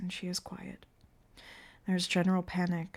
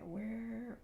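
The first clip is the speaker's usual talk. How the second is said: ordinary delivery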